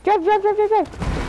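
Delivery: high, rapid repeated commands; frantic urging. Real, not acted. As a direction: push them to drive now. Urging teammate to drive.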